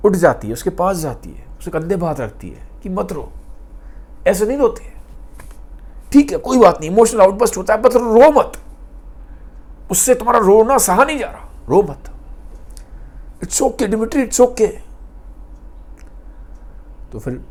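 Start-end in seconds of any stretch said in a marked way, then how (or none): 2.9-14.7 comforting. Retold or imitated. Grushenka comforting crying Dimitri.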